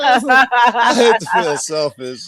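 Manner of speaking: slow, high, said through a laugh; laughing while getting the words out. Real, not acted. [name] laughing at the host's news, slow and giggly.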